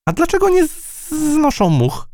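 squeaky-high fly with stretched z sounds; very high voice, buzz on every z. Acted. Fly wonders aloud to the mouse, buzzing high.

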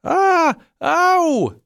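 very high, loud squeaks of fright; a tangled mouse yelping in fear; muffled squeal. Acted. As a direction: squeal with a gagged snout. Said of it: "wydobyła z siebie".

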